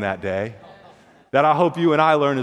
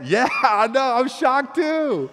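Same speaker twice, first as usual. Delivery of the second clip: put-on much higher, stunned kid; can't believe it, pitched up high; amazed friend; 8 semitones higher, much slower than usual. Boy answers friend.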